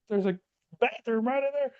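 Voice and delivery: high squeaky mock instructor voice; shrill up the pitch like a cartoon instructor; mock drill instructor. Instructor grilling the trainee who wet himself, high mock voice.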